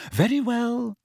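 high, strained assent; voice cracking. A narrator's reading. Mother overruled at the table, voice cracking. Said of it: "her voice cracking".

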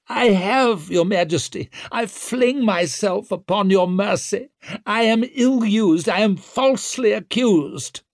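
high, loud protest; high-pitched, hammering appeal to a king; Wetterland, indignant prisoner. Acted. Wetterland pleads his innocence.

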